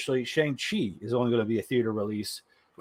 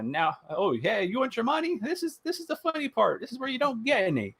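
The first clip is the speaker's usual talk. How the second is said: much higher, sing-song gloating; mocking meme voice; 11 semitones higher than usual. Meme Disney mocking Scarlett over money, high gloating. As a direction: gloat like a cartoon villain. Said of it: "It was like".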